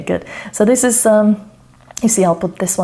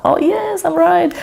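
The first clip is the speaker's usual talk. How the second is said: delighted; 11 semitones higher than usual. Real, not acted. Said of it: "delighted at guessing right".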